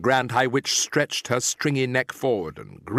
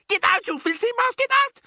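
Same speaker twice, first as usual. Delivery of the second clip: shout. Acted. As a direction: scream it at the mice. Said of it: "They stand upon their desks and shout".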